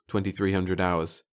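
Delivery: ordinary delivery, nothing marked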